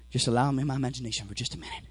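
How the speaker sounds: normal talking voice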